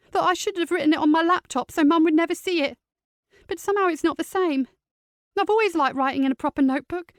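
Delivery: put-on young niece, hurt and tense; teenage girl voice. Teen niece Sophie voiced.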